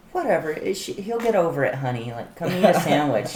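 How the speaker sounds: high, breezy grandma voice; unbothered grandma. Retold or imitated. Grandma shrugging off the fight, high breezy voice. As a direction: brush it off like a grandma who doesn't care. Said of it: "She was like".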